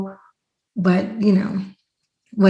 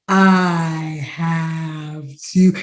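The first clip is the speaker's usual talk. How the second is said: very slow, low drawl; acted-out stoned slow motion; slow-motion speech; 4 semitones lower, much slower than usual. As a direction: drag each word out as if time has slowed. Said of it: "it felt like I said".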